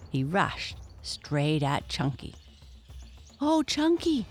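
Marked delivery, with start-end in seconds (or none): 3.4-4.3 high, alarmed cry for her brother; frightened cry. Acted. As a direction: cry out in fear as the bully charges. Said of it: "cried Mumpy as she saw Bigfoot rushing".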